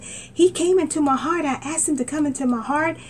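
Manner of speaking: high, eager call to strangers; high excited witness to bus riders. Retold or imitated. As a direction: share the news bursting with joy. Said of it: "And I said".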